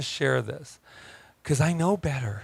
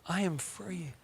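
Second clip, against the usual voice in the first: softer, higher echo for the crowd; soft repeat; 4 semitones higher, 12 dB quieter than usual. Shown as a demonstration. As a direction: say it gently with them. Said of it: "Can you say it with me?".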